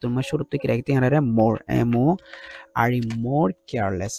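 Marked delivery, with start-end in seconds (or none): 1.8-4.1 very slow, spelled-out dictation; slow classroom dictation. Shown as a demonstration. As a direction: let them write it as you speak. Teacher dictates the answer.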